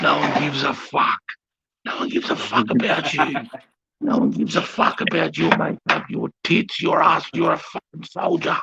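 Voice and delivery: high, strained furious tirade re-enacted; acted-out angry dressing-down; losing his nut. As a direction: tear strips off a soldier, pitched right up. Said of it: "I did my fucking nut".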